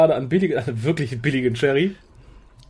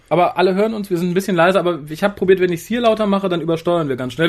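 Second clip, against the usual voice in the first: ordinary talk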